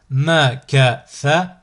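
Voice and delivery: slow, each syllable drawn out separately; split into syllables. Shown as a demonstration. Teacher models a word for learners to repeat, split into syllables. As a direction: cut the word up, pausing between syllables. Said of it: "décomposer le mot".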